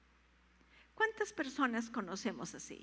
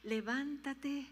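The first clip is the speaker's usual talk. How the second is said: slow call; 3 semitones higher, slower than usual. Shown as a demonstration. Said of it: "nos está diciendo".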